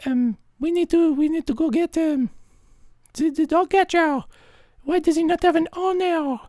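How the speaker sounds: very high-pitched fretting voice; mock-concerned bystander falsetto; worried onlookers. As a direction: put on a high voice of alarmed passers-by. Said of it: "then people say".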